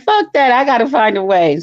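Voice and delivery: high, loud determined outburst; loud exclamation. Retold or imitated. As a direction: belt it out, fed up. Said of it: "I was like".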